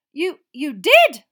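slow, loud, stammering disbelief; can't believe what she heard; stunned stammer. Acted. McGonagall stunned.

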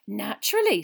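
amused, loud reply; touch of amusement in a barmaid's voice; small laugh. A narrator's reading. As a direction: answer as if lightly amused. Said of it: "with a small laugh".

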